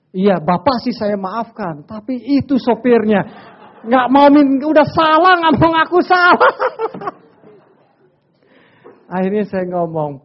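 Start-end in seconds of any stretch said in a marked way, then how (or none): 0.1-6.5 rising, louder, half-laughing indignation; mock indignant complaint. Officer scolding about the driver, mock indignant. Retold angry officer refusing apology. 6.6-7.1 a quick high-pitched laugh; short high laugh. Real, not acted. Preacher laughing at his own story, short high laugh.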